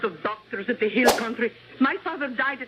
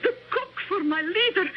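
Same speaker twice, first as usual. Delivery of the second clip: overwhelmed surprise; 9 semitones higher, slower than usual. Acted. Cook overwhelmed by honor.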